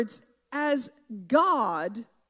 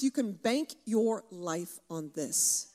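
ordinary talk, nothing marked

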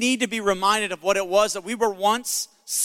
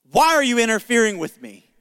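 louder, emphatic retold challenge; challenging quote; 3 semitones higher, 5 dB louder than usual. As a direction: throw the question out forcefully, hitting "Why". Repeats the quote as indignant challenge.

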